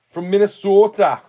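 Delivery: high, loud Minnesota accent on "From Minnesota", long round o in "sota". Shown as a demonstration. Trying the Minnesota accent for the stream, high and loud. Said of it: "Is that how you say it?".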